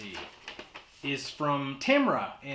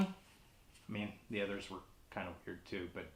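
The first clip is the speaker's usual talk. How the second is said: quiet whispered aside; muttered aside; 5 semitones lower, 13 dB quieter than usual. Real, not acted. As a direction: drop to a hushed aside. Aside under his breath.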